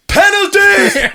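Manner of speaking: high, loud, slow laughing yell; mock shout. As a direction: bellow it like a referee's call. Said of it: "he just goes".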